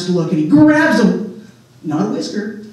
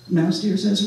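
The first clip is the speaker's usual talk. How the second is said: ordinary delivery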